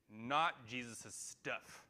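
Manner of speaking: not stressed, slow and deliberate; slow emphatic contrast on not; emphasized contrast. Shown as a demonstration. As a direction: hit not, then slow down. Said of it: "I'm gonna emphasize that".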